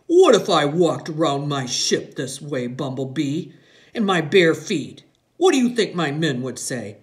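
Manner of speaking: loud, hearty fatherly teasing; lightly voiced jovial navy dad; playful father. A narrator's reading. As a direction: tease a small daughter in a big voice. Father teases his small daughter.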